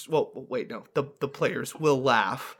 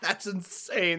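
high mock laughing disbelief; hammed-up amused outburst; players cracking up; 7 semitones higher, 7 dB louder than usual. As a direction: act out a player breaking into laughter. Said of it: "intermittence where it's like".

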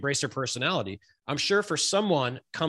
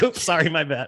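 high, loud, laughing embarrassment; laughing apology; 5 semitones higher, 5 dB louder than usual. Real, not acted. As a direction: apologize through laughter over your slip. Laughing over own mistake.

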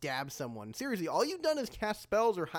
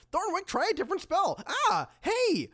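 high-pitched frantic plea; panicked call; 12 semitones higher than usual. Acted. Fighter under attack calling to the wizard, panicked. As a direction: call out while dodging, ending in a yelp. Dodging blows, calls to wizard.

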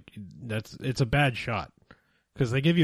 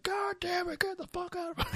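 mock furious chief, squeaking way up high; hammed-up rage that cracks into a high squeal; imitating the chief losing it in a strained high pitch; chief freaking out; 6 dB quieter than usual. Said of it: "the quintessential chief freaking out".

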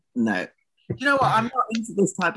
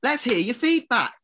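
teasing demand; 9 semitones higher than usual. Pretend.

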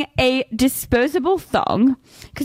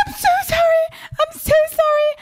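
much higher, frantic repeated apology; panicked apology. Retold or imitated. Salon girl apologising in panic, high frantic voice. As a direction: squeak it out in a panic. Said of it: "she was like".

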